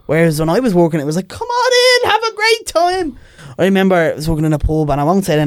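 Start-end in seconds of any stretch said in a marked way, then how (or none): 1.3-3.2 high-pitched, drawn-out welcome; over-the-top cheery invitation; eager welcome. Retold or imitated. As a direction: usher them in with a high, sing-out call. Acted welcome to pub customers.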